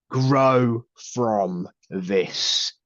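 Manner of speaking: very slow, firm, deliberate Grow from this; firmer. Retold or imitated. Medium passing on the father's firm message to his daughter, very slow and firm. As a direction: lay down the words like a father's firm advice. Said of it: "he does get a little bit more, you know, firmer".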